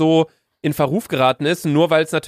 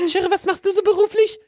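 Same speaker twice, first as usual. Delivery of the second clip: put-on high girly voice. Lara voiced in skit.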